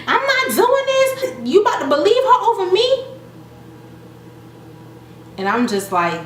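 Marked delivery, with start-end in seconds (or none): 0.1-3.0 mock furious wife, pitched way up; Lyrica losing it. Lyrica blowing up, acted high.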